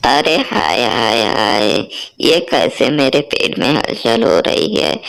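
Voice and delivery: put-on low, groaning old woman's voice, stretching the hai hai; hungry old mother-in-law. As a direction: moan like a starving mother-in-law.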